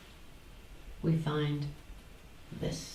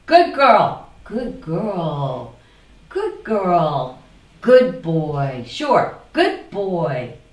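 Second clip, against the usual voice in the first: smug certainty; 7 dB louder, much slower than usual. Acted. Acting the sure voice.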